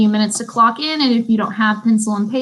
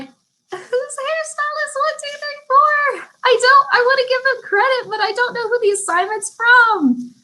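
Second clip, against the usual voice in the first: confused high voice; 14 semitones higher than usual. Retold or imitated. Teacher puzzling over an unsigned assignment, baffled and high. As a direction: squeak out the question in baffled disbelief. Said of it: "And I'll just be like".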